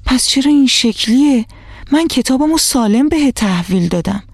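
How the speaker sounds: dismayed at the torn book; a child's aggrieved protest; upset. A narrator's reading. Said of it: "با ناراحتی گفت".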